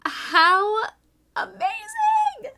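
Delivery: loud, very high, slow squeal; ecstatic amazement. Real, not acted. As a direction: belt it out sky-high, thrilled. Gushing over handmade hook.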